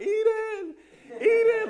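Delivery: high, loud calls of the dog's name; calling the dog. Retold or imitated. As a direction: shout her name down the street, half laughing. Searching the neighborhood for lost dog.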